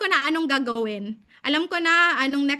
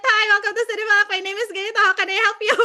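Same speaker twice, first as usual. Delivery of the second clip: higher, louder, fast agent greeting with a laugh breaking through; flustered repeat of the spiel; 5 semitones higher, 5 dB louder, faster than usual. Retold or imitated. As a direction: rush the script again after waking up, half laughing. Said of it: "sabi ko ulit". (nagmamadaling spiel na mas mataas at malakas, may tawa)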